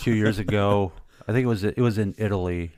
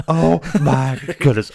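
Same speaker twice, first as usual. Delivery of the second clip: stunned; 6 semitones higher, 7 dB louder than usual. Retold or imitated. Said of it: "I'm like".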